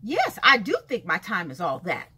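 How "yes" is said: ordinary talk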